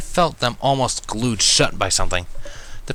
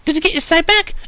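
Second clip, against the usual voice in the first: very high and louder, bursting; excited Pinky; 7 dB louder than usual. A narrator's reading. Pinky excitedly asks if he can see, very high. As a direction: bounce with eager excitement. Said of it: "Pinky gasped".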